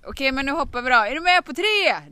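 rally her, loud and high; loud rallying call. Retold or imitated. Husband urging his wife to jump on three, loud.

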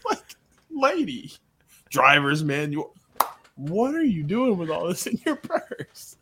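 words breaking into laughter, pitch jumping; laughing through it. Real, not acted. Joking about a sitcom mom's purse, laughing through the words. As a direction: crack up mid-list. Cracking up at own joke.

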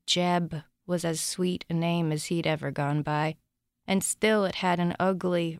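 usual speaking voice

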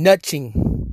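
"nothing" said as "nutching", with a hard ch; emphatic NUT-CHING. Real, not acted. Fan griping that Dallas ain't doing it, emphatic nutching. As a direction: stretch "nothing" into two punched syllables, NUT-CHING. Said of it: "I said nothing. I didn't say nothing".

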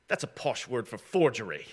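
lightly imitated British scholar; hint of a posh British voice; British accent. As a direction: put on a British accent for the quote. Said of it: "he's a British guy".

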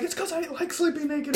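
high, strained defensive protest; mock yelling. Naked friend justifying himself to intruder, mock yelling. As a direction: protest in a high voice, half laughing. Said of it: "while you're both yelling".

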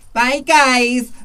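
a cheery Geordie send-off, lightly put on; Geordie accent. Retelling a friend's goodbye in a light, bright Geordie accent. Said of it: "Geordie accent".

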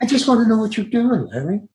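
high-pitched director impression, confiding; imitated director's aside. Director asking his actor privately, imitated in a high-pitched voice. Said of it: "that's how you would talk to me".

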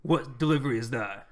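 a hint of a booming voice behind the door. Reptilian questioning the visitors about a delivery, in a lightly booming voice. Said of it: "booming voice".

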